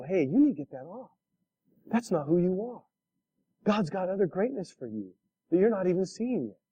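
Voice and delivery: soft, confiding friend's voice; gentle urging. Acted. Friend helping someone see what holds them back, gentle urging. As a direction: speak softly, as a caring friend would.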